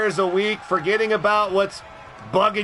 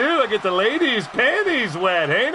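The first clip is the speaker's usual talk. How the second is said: usual speaking voice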